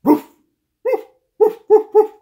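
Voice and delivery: loud, high, short woofs; dog bark. Shown as a demonstration. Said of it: "bark like a dog".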